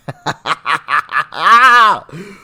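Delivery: loud burst of laughter; big laugh. Real, not acted. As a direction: crack up loudly. Host laughs at clip.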